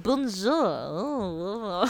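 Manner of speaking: long, drawn-out wavering French greeting; mock French. Imitating French people for co-host, hammed-up drawn-out French. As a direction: croon it in an exaggerated French accent. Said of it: "French people are like".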